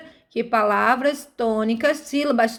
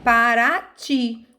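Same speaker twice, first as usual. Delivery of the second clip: Paraty slowly, final TY drawn out; teacher's demo, Paraty with a long ending; stressed last syllable; much slower than usual. Shown as a demonstration. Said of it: "a sílaba tônica é ti".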